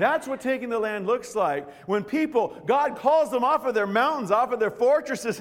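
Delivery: loud, high impassioned preaching; full-force preaching. Acted. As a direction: pour your heart out to the congregation, full voice.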